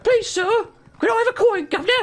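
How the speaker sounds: high, pleading mock beggar voice; begging urchin. What a beggar should say to a passer-by, mock pleading. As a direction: beg in a hammed-up, very high voice. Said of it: "instead of being like".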